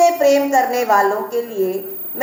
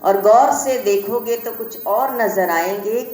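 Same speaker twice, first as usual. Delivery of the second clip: shayari recitation; 3 semitones lower than usual. Acted. Poet to the college audience, quick low shayari. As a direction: rattle off the couplet fast and low, fading into the last line.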